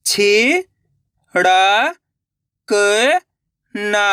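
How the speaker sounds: शिकायत stretched out slowly, sound by sound, rising at the end; slow syllable by syllable. Shown as a demonstration. Reading teacher sounding out a word for children, slowly syllable by syllable. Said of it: "मेरे तरीके पे गौर करना है".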